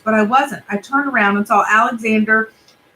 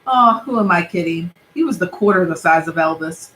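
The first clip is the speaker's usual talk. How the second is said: usual speaking voice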